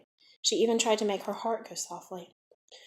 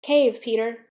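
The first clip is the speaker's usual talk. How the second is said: louder warning call; crying out; 3 semitones higher, 8 dB louder, slower than usual. A narrator's reading. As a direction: warn him urgently. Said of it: "the smallest star of all cried out".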